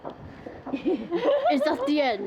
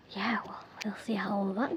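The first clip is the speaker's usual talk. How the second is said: whispering; 7 semitones lower, 9 dB quieter, faster than usual. Real, not acted. Aside about filming tonight.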